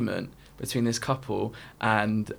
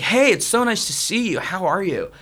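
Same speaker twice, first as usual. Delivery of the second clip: higher-pitched, effusive stranger's hello; feigned friendly greeting; 6 semitones higher, 3 dB louder than usual. Retold or imitated. American guy greeting strangers in a queue, mock-friendly. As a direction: greet them like a long-lost friend. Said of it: "some American guy came up to us".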